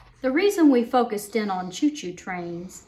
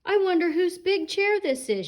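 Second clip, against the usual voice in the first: Goldilocks voice; 9 semitones higher than usual. Acted.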